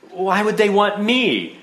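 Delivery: put-on high, slow child's question; drawn-out, high-pitched bewilderment; puzzled child. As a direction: ask as a baffled little kid wiping away tears. Sobbing child asks in disbelief.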